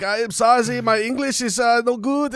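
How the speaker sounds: high mock German apology; German accent. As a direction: lightly play an apologetic German fan. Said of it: "the guy who's like".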